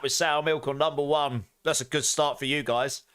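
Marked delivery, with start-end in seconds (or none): none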